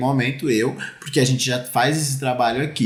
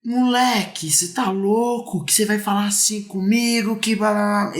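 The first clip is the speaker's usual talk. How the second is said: put-on furious boy; 7 semitones higher than usual.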